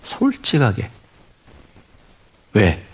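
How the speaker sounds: ordinary delivery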